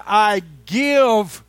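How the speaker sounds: very slow, loud, stretched-out proclamation; drawn-out preacher's declaration; slow emphatic preaching. Acted. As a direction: lay each word down like a gift. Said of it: "He said".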